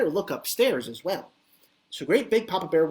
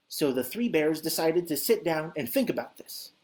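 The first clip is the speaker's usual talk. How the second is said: usual speaking voice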